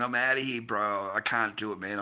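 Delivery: ordinary delivery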